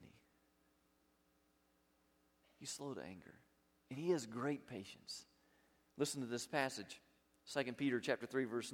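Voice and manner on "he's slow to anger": usual speaking voice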